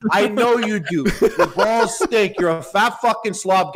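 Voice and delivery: ordinary delivery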